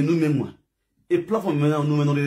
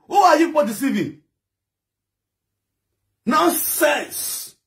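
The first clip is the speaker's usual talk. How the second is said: angry shout; 8 semitones higher, slower than usual. Real, not acted. Ranting at live-video mourners.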